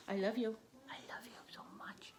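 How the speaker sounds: soft whispered love. Real, not acted. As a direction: whisper it tenderly. Whispering to dog.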